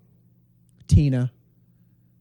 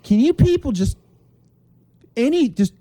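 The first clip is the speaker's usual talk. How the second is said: high, loud, strained exasperation; pitched-up plea that runs out of words; exasperated outburst; 8 semitones higher, 4 dB louder than usual. Acted. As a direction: sputter at everyone, fed up. Acted exasperation at the commenters.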